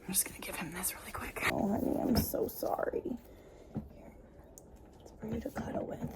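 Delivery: soft, fast whisper to a pet; real gentle whispering. Real, not acted. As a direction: hush so as not to startle him. Whispering to the scared animal.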